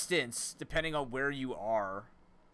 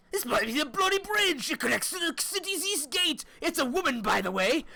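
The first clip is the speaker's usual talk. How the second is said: much higher, loud hammed-up British accent; exaggerated British voice, high and loud; 7 dB louder than usual. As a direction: play an over-the-top British tour guide. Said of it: "Why would you want me to do a British accent?".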